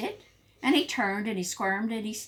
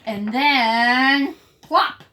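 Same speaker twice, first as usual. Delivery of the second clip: slow plop; 3 semitones higher, 9 dB louder, much slower than usual. A narrator's reading. Dramatic landing.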